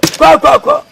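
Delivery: high, sharp shooing cry. Acted. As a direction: chase them off with a high yelp. Shooing someone away.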